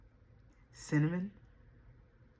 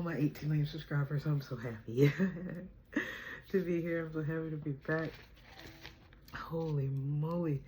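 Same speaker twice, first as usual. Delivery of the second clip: normal talking voice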